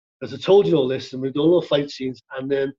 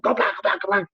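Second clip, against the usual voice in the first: higher, words run together like gunfire; rapid-fire mock shout; 5 semitones higher than usual. Shown as a demonstration. Passionate stuntman warning his fight partner, rapid-fire mock shout. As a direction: rattle it off in passionate, garbled Scouse. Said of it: "it goes faster and faster and faster".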